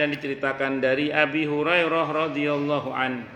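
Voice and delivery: slow, measured Arabic recitation; hadith recitation. Acted. Preacher reciting a hadith chain to the congregation, slow and measured. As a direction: intone the chain of narrators slowly.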